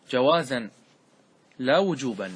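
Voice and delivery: usual speaking voice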